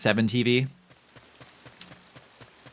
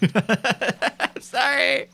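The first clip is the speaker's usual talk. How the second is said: laughing apology; 14 semitones higher, much slower than usual. Real, not acted. Giggling apology after outburst.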